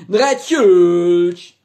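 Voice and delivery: shouted name backwards; backwards speech. Acted. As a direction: yell it in reverse. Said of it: "rückwärts".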